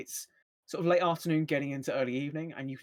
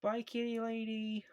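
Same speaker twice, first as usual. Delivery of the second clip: put-on high, slow little-kid voice; child's farewell; 7 semitones higher, 4 dB quieter than usual. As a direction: call out a drawn-out childlike goodbye. Game master voices child's farewell.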